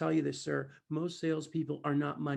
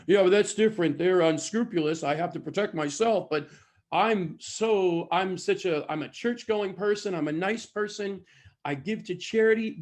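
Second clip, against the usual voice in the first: loud, raised, defensive protest; mock self-righteous; 3 semitones higher, 7 dB louder than usual. People justifying how they buy, mock self-righteous. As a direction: imitate people excusing themselves indignantly.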